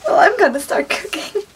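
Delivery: high voice, still tearful; teary, high-pitched resolve; crying. Real, not acted. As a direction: pull yourself together after real tears. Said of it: "I shouldn't cry over a cookbook".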